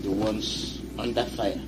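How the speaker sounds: loud rallying radio sermon; forceful preaching. Real, not acted. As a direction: drive each line home with force.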